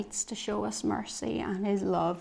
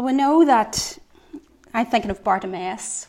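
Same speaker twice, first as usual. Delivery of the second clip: normal talking voice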